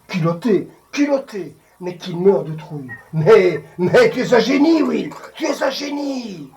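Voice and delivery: loud, high-pitched outburst; emportement. Acted. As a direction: burst out at him, building to a shout. Acted outburst at Will, climax of speech. (éclat de voix, véhément et aigu)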